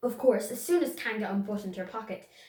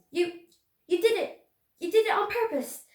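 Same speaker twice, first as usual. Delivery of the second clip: high, loud indignant splutter; 10 semitones higher, 5 dB louder than usual. A narrator's reading. Piglet spluttering at Kanga through the soap, high and loud. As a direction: accuse her through soap. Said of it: "spluttered Piglet".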